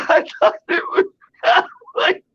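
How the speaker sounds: high breathless squeal of laughter, words lost in giggles; laughing hysterically. Retold or imitated. Manager finds out the owl is fake, laughing hysterically. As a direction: laugh so hard the words barely come out. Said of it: "laughing like crazy".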